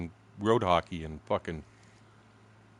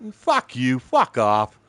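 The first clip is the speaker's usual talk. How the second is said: loud mock yell of abuse; angry retort; 5 semitones higher, 9 dB louder, much slower than usual. Telling the judge to fuck off, mock angry yell. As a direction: spit it out loudly, retelling a row.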